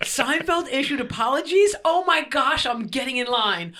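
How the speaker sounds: high, loud burst of delighted disbelief; gleeful excitement. Real, not acted. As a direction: crow over unexpected good news. Gleeful reaction to rival's apology.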